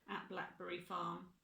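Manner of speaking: usual speaking voice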